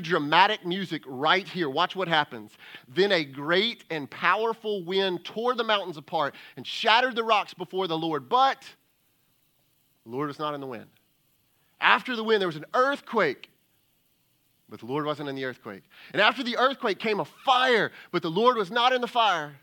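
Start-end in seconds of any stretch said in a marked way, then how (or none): 2.9-8.7 loud, rising preached build; loud dramatic preaching; dramatic scripture reading. Acted. Dramatic reading of wind passage. 11.8-13.5 loud, rising preached build; loud dramatic preaching; dramatic scripture reading. Acted. 16.1-19.5 loud, rising preached build; loud dramatic preaching; dramatic scripture reading. Acted.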